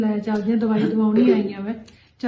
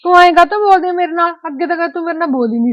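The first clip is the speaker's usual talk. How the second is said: loud, high, hurt outburst; angry demand; 6 semitones higher, 7 dB louder, faster than usual. Acted. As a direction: demand an answer loudly. Acted quarrel between in-laws.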